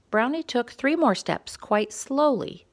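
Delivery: plain reading voice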